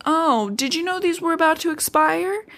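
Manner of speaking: high, put-on casual innocence; lightly mocking boyfriend voice; mock Edward. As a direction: voice him as sly and innocent. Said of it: "He's like".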